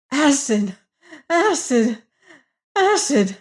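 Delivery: terrified gasps. A narrator's reading. Prisoner telling the warden what the voice said, gasping in terror. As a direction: gasp each word out in horror. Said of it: "gasped the prisoner".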